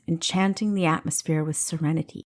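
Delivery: ordinary delivery, nothing marked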